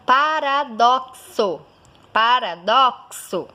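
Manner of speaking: "paradoxo" slow and split, PA-RA-DOK-SO; emphatic, drawn-out "paradoxo" with clear KS; slow KS demonstration. Shown as a demonstration. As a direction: stretch "paradoxo" syllable by syllable. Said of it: "Outro exemplo, a palavra".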